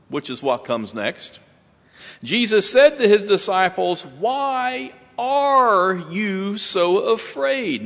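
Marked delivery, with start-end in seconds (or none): 4.1-7.8 "are" drawn out and raised high; stress on are. Shown as a demonstration. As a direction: slowly lean on the word "are". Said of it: "Notice the present tense".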